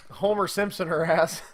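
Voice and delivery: ordinary delivery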